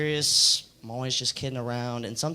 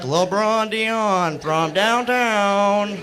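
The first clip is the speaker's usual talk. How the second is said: loud, high, hugely stretched sports call; drawn-out play-by-play holler, imitated; commentator's shout; 6 semitones higher, 7 dB louder, much slower than usual. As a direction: call it like a long-range basket. Friends chanting it like a commentator.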